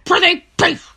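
theatrical Shakespeare recital, loud finish; hammy stage actor. Pretend. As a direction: declaim like an old stage actor. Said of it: "Shakespeare who once said".

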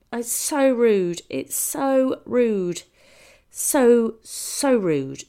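loud, slow stress on "so rude"; emphatic scolding. Retold or imitated. Comic on being talked over, "so rude" loud, slow and scolding. Berating the talking audience member.

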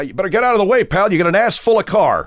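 irate driver telling a stranger off; pissed-off father. Retold or imitated. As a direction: threaten another driver like an angry dad. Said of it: "he'd be pissed".

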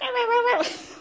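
high yelping squeal; mock a wild shriek of upset; high, hammed-up squeal; imitated shrill noise. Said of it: "just a series of noises".

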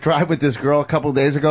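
ordinary talk, nothing marked

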